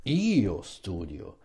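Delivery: IO stretched and pushed hard in "io studio"; emphasis on IO. Shown as a demonstration. Teacher shows how Italians emphasise, strong stress on IO. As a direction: lean heavily on "io", then let "studio" fall. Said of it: "to put the emphasis".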